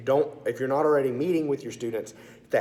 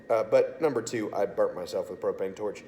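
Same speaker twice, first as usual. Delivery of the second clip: usual speaking voice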